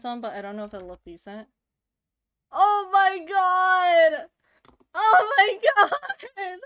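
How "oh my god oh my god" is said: loud, high, slow squeal of delight; excited squeal. Real, not acted. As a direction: shriek over something adorable. Sees cute fan art.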